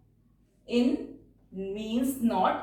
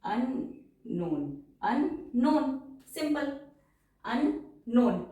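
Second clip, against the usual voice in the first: slow dictation; 3 semitones higher, much slower than usual. Shown as a demonstration. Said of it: "दो बार न की sound आ गई".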